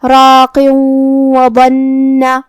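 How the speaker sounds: راق merging into وبنہ with nasal ghunna, slow; idgham with ghunna. Shown as a demonstration. Teacher demonstrates idgham, slow nasal merge. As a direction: merge the tanween into واو with a held hum. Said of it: "تنوین کو واو میں ملا کے غنہ اور تشدید کے ساتھ".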